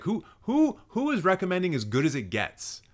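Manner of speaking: high-pitched incredulous questioning; mock-bewildered, voice jumping up high; baffled. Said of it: "I'm just like".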